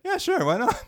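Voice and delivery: breezy mock reply. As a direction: toss it off as a caricatured carefree mom. Voicing the mother's breezy reply.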